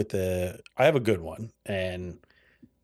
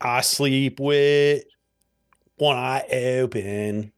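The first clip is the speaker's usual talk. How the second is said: with clipped to wit, slow South Philly drawl; South Philly accent; much slower than usual. Shown as a demonstration. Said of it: "Like wit".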